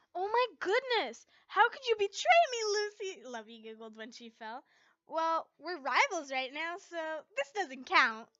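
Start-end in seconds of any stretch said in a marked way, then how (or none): 0.1-3.2 loud, high, hammed-up betrayal; mock outrage. A narrator's reading. Levy mock betrayal, giggling. 5.1-8.3 smug teasing. A narrator's reading. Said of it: "I smirked evilly at Levy".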